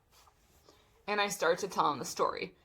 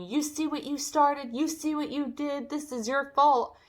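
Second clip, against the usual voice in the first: raised pitch, retold tirade; imitated scolding scream; 7 semitones higher than usual. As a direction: mimic a screaming, blaming neighbor. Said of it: "And then Drizella screaming".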